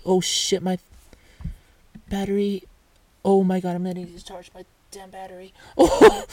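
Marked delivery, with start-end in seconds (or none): 5.8-6.2 laughing. Real, not acted. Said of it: "laughs before this".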